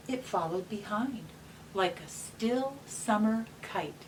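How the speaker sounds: gentle storybook reading; reading to children. Acted. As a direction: read it like a bedtime picture book. Reads picture book aloud.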